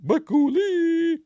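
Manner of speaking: very high, slow call with a put-on sound; mock call of the city's name; drawn-out call. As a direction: stretch it out like the Lazuli call. Echoing the Lazuli call.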